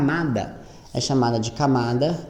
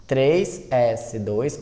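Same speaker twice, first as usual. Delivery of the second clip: ditando devagar; much slower than usual. Shown as a demonstration. Professora dita a distribuição.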